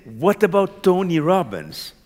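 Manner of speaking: retelling a stubborn objection with force; obstinate retort. Retold or imitated. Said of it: "I was a little obstinate".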